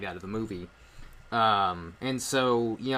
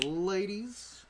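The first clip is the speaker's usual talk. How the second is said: smooth ladies' man; 8 semitones higher than usual. Retold or imitated. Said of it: "He's just kinda like".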